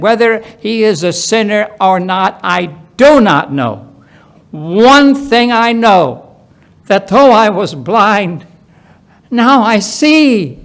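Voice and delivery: insistent healed man. Acted. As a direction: plant your feet and insist on what you know.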